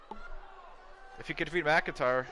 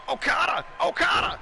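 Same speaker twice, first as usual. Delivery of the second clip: Okada! shouted much higher and louder each time; rising, ecstatic shout, louder the second time; excited yell; 7 dB louder than usual. Real, not acted. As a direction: scream the name like the crowd's on its feet. Commentator reacts to finisher.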